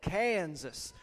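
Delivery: "Kansas" in an exaggerated drawl. Shown as a demonstration. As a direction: stretch out the first syllable of "Kansas". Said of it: "You have to kinda get a drawl".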